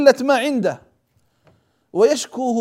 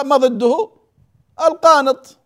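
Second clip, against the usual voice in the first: usual speaking voice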